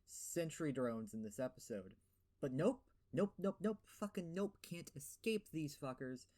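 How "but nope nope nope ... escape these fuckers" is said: exasperated. Real, not acted. Exasperated at enemy drones.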